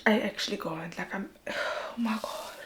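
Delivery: sighing whisper, out of words; overwhelmed whisper. Real, not acted. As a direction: whisper through a sigh, emotionally drained. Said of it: "I'm actually, like, shaking".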